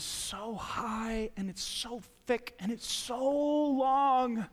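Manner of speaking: slow, stretched description; drawn out. Real, not acted. Pastor describing the huge wall of fear, slow and stretched. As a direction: stretch each phrase to show the size.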